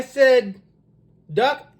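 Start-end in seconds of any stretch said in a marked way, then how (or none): none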